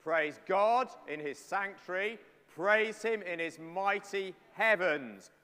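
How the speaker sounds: slow, measured call to praise; psalm recitation. Acted. Preacher leading the congregation through Psalm 150, slow and declaiming. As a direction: recite each line slowly for the room to follow.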